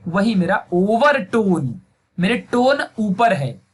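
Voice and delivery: ordinary delivery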